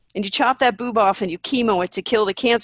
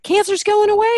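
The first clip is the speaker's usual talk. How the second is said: mock-joyful voice, pitched much higher; excited clients; 11 semitones higher, 4 dB louder than usual. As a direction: gush like a thrilled client sharing good news. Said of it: "They're like".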